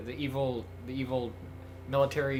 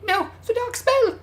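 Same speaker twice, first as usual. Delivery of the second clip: very high-pitched put-on character voice; frantic warning figure; 4 dB louder than usual. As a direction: warn of dark magic in a squeaky falsetto. Same put-on character cries warning.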